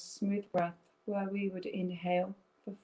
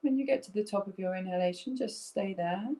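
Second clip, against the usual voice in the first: guided breathing meditation; 2 semitones lower than usual. Acted. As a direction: lead them gently through each breath.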